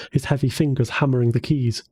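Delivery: ordinary delivery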